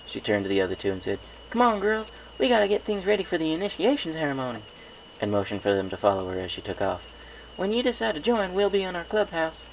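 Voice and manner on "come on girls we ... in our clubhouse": high little-sister voice, coaxing; a small filly asking big sis a favour; young filly. A narrator's reading. Apple Bloom rallying friends.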